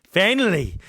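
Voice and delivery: a loud, relieved "finally" with a falling end; "finally" as a giddy outburst; excited. Retold or imitated. Buzzing at finally losing virginity.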